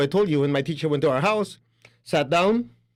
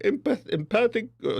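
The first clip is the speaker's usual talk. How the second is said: ordinary delivery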